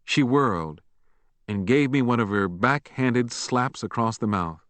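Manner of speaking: ordinary delivery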